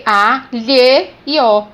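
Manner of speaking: A, Lhe, O as slow separate sounds. Shown as a demonstration. Teacher counting the phonemes of galho, slow separated A, Lhe, O. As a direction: spell out A, Lhe, O slowly by sound. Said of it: "eu tenho o som".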